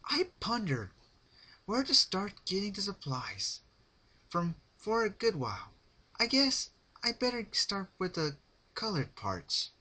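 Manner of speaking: high, chatty and bright; cheery psycho Pinkie. A narrator's reading. Pinkie explaining her plans to Rarity, high and chipper. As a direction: chat about it like planning a craft project.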